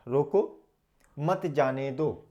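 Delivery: रोको alone, then slow, joined मत जाने दो; pause after रोको. Shown as a demonstration. Teacher explaining how meaning changes, pausing after रोको. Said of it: "यानी कि उसको रोको".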